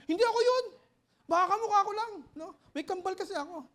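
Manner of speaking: high, loud hammed-up denial; mock panicked denial. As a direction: deny it in a comic panic. Comic pretend denial.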